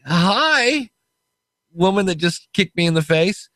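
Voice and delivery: high, sing-song sarcastic hello; mock polite greeting. He greets the woman who just kicked him, high mock cheer. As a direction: greet her with fake cheer.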